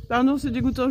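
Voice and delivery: apologising through a mouthful; chewing while talking; mouth full. Real, not acted. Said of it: "je vous parle la bouche pleine".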